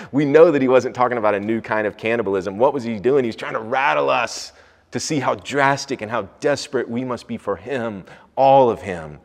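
loud, high, driving sermon voice; fervent preaching. Acted. Pastor preaching to his church, loud and fervent. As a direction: preach it with full force to the congregation.

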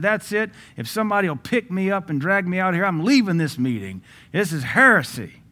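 high, loud indignant protest; offended outburst. Acted. Offended man walking out of the meeting, indignant. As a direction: storm out in outrage. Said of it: "he cussed and called him blasphemous".

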